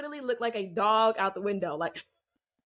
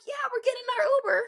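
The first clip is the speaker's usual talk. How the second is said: very high, fast and louder cover story; insisting to the guys it's an Uber; nervous fake cover; 13 semitones higher, 4 dB louder, much faster than usual. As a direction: rush the lie out up high. Sticking with the lie outside.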